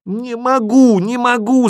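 high, loud desperate cry; desperate shout. A narrator's reading. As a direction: scream it in despair. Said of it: "закричал я отчаянно".

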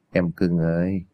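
low, softened leer at the victim; leering villain. A narrator's reading. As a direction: coax her creepily, low.

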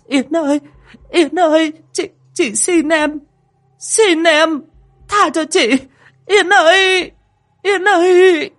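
very high, loud terrified begging, building; screaming in agony. Acted. Dung begging the ghost for mercy, screaming. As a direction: plead for mercy in rising shrieks. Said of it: "mà gào mà thét".

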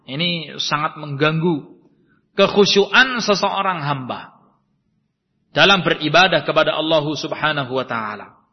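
ordinary talk

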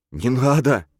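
pleading. Acted. Murphy begging the hero not to shoot, a high frightened plea. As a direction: beg desperately to be spared. Captive begs not to be shot.

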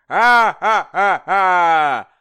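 loud high roaring laugh-shout; king's thunderous roar; roaring guffaw. Acted. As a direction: let out a booming cruel roar. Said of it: "загрохотал тот".

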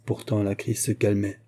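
usual speaking voice

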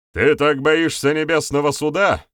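taunting question, high and loud; mocking. A narrator's reading. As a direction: jeer at a cowardly opponent. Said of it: "с усмешкой спросил Барята".

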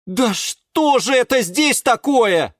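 slow, high-pitched dismay; high, drawn-out indignant question; shocked exclamation. A narrator's reading. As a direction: burst in on a mess. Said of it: "раздалось с порога".

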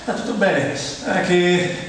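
usual speaking voice

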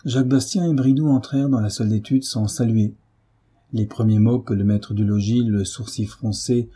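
plain reading voice

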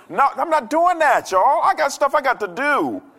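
high-pitched, loud refusal; loud protest. Acted. Preacher telling congregation he won't wait by phone, loud protest. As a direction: raise the voice way up in playful indignation.

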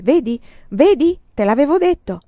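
put-on high, loud triumphant child's voice; a boy proving he was right, shouting. Child triumphantly shows old shoes.

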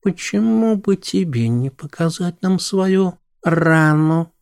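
gently coaxing, slow. Acted. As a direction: ask it softly and slowly. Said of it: "спросила мягче". (мягко и медленно)

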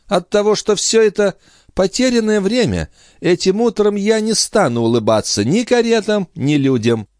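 high-pitched, irritated lady; peevish countess. A narrator's reading. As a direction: grumble crossly in a high lady's voice. Countess voiced, cross morning mood.

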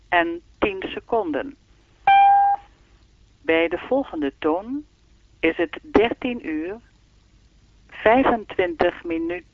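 even, measured time announcement; speaking clock voice. Acted. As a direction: read the time like the recorded clock. (zoals de sprekende klok)

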